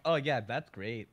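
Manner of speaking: drawn-out, slow mock enthusiasm; mimicked sarcastic delivery. Mimicking the streamer's great reaction, sarcastic. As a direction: imitate an unintentionally sarcastic remark, slowly. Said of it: "the way that you said that".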